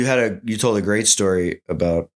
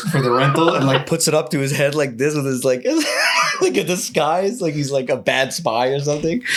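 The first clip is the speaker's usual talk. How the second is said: laughing while telling; 6 semitones higher than usual. Real, not acted. Mimicking friend's bad spy disguise.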